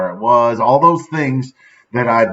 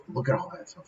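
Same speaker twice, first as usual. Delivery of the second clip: whispered aside, no voice; hushed look at all that stuff; 14 dB quieter than usual. Real, not acted. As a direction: breathe it under your breath. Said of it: "whispered aside about card".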